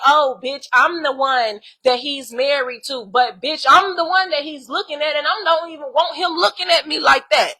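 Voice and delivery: jealous wife mocked, snotty and loud; mock catty wife. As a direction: sneer it like a jealous wife showing off. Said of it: "But you trying to bully me like".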